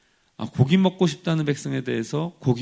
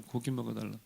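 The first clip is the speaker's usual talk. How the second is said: 고기 먹여달라고 said much softer, trailing away; softly repeated; 2 semitones lower, 11 dB quieter, faster than usual. Shown as a demonstration. Preacher echoing the people's demand, softer and fading. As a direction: let 고기 먹여달라고 fall to almost nothing.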